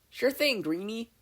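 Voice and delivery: high, loud, carefree; breezy Mei. Acted. Mei brushing off Izuku's name, breezy. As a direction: toss off a nickname.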